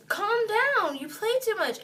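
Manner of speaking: high, loud exasperated scolding; retold scolding. Injured teller to drunk friend who won't listen, exasperated scolding. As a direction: re-enact telling someone off, pitch up.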